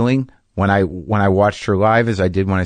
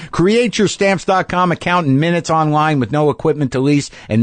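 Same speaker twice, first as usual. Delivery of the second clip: upbeat, higher sponsor read; 7 semitones higher than usual. Acted. Host reading the sponsor message to listeners, upbeat ad read. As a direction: sell it like a podcast ad spot.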